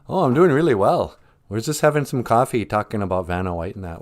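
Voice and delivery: usual speaking voice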